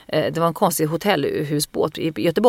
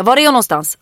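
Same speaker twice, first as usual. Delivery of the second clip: louder, flat-hard police command; robotic cop voice; 6 semitones higher, 7 dB louder than usual. Retold or imitated. She pushes the man to let her in, in cop voice. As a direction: bark it like an unbending robot cop. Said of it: "jag är fan en jävla robot".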